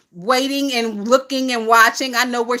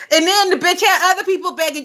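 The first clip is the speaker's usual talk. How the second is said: ordinary talk, nothing marked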